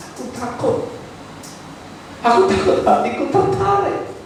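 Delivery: fearful confession. Acted. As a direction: voice a worried believer confessing. Voicing a fearful believer.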